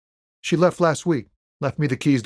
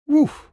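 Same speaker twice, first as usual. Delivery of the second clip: very high, short whoop of breath; sudden high oof of reaction; exclaimed oof; 4 dB louder than usual. Acted. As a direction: let out a high-pitched woof. Reacting to news about her.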